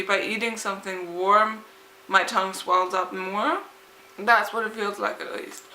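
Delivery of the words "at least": ordinary delivery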